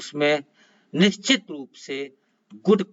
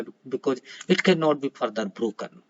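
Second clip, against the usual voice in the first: normal talking voice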